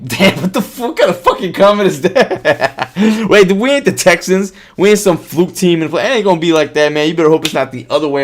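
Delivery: high, loud, laughing disbelief at the comment; indignant laughing protest; laughing outrage. Real, not acted. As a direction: scoff and laugh it off, fired up.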